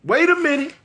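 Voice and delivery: high urgent hold up; pleading alarm; high panicked wait a minute. Retold or imitated. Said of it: "I say".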